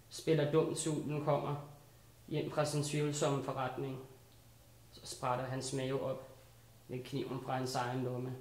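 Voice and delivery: low, hushed spoken-word delivery with pauses between short lines; restrained spoken-word poem; poetry recitation. Acted. Recites a dark fairy-tale poem.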